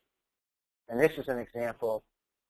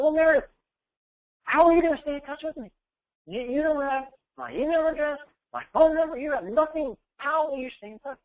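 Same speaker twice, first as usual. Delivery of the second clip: raised, high, loud voice carrying across a room; calling out; 13 semitones higher, 6 dB louder than usual. Retold or imitated. Speaker calling across to the student, loud high scolding. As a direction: keep pressing her loudly from a distance.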